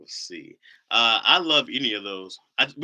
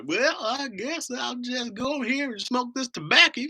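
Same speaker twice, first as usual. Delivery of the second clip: mock country bumpkin; 14 semitones higher than usual. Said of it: "they think that's how you eat and talk".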